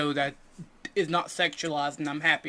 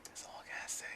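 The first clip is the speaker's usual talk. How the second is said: whisper; 24 dB quieter, faster than usual. Acted. Whispered sign-off on Usopp.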